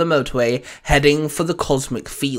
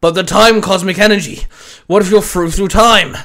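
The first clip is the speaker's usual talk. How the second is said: raised, urgent voice; alarmed question; 3 semitones higher, 6 dB louder than usual. Acted. Commanding officer worries Winston will be lost in time, alarmed. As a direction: press the warning, louder.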